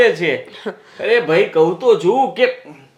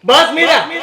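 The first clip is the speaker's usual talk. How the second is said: sharp cut-off; 5 semitones higher, 4 dB louder than usual. Acted. Husband cuts wife off.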